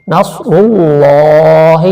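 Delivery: slow chanted tajweed recitation; Quran recitation. Shown as a demonstration. Teacher demonstrating recitation to students, slow and chanted. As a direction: draw out each syllable in melodic recitation. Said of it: "لاہی".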